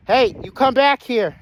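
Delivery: loud, high holler; real urgent call back; shouted command. Real, not acted. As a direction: yell after a runaway. Calling his runaway Jake back.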